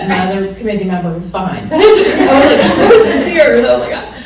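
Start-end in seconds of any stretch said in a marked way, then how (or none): 1.7-4.1 loud laughing, higher. Real, not acted. Recalling crying over her dissertation, loud laughter. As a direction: laugh hard while telling it.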